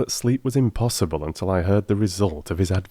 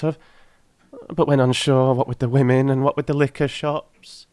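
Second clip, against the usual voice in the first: put-on high, pleading old crone voice; a poor old charwoman begging humbly; old woman; 7 semitones higher, 4 dB louder than usual. Old woman begs the gentlemen for the ring, high and humble.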